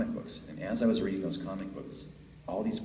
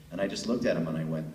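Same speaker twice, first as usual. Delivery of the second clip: normal talking voice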